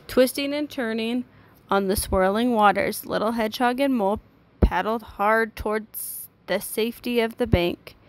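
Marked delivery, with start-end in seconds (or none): none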